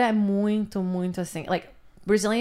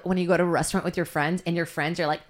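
ordinary delivery

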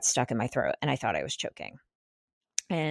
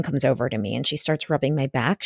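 ordinary delivery

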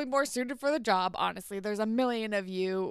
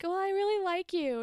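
mock sweet confession; 11 semitones higher than usual. Jane's confession, acted quote.

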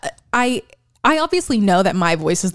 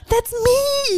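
much higher, prissy put-on voice; mock fancy high voice. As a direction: squeak it out, posh and high. Mocking her fancy voice.

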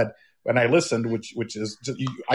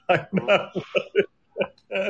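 laughing through the line; giggling repeat of the quote; 5 semitones higher than usual. Retold or imitated. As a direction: crack up while repeating it.

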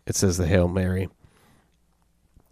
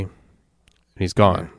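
usual speaking voice

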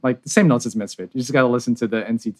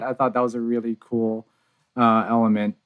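normal talking voice

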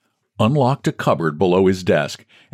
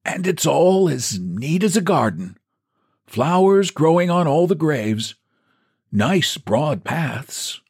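put-on high old voice, slowing on the last words; quavering old man; 9 semitones higher than usual. Old clerk fondly describing the cemetery paths. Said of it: "quavered old Woodfield".

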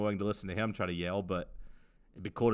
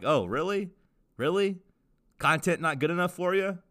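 mock incredulous, pitch raised; side-eye sarcasm; 6 semitones higher than usual. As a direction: tease the listeners with fake disbelief. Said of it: "We're looking at you with the side eye".

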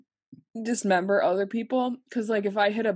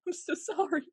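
put-on sorry baby voice; 10 semitones higher than usual. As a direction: coo an apology to a tiny animal. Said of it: "I'm like".

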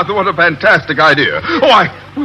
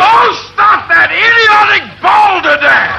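high, loud angry outburst; furious shout; 13 semitones higher, 3 dB louder than usual. Acted. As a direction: snap it out in panic. Captain snaps at Miss Brooks.

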